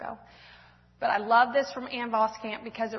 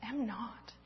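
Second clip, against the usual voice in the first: soft, hushed denial; whispered; 14 dB quieter than usual. Acted. Louise quietly denying she is a scaredy cat, whispered. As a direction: barely breathe it out. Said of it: "whispered Louise".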